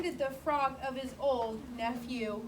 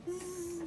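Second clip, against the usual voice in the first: snoring sound; 3 semitones higher, 7 dB quieter than usual. Acted. Sheep are sleeping.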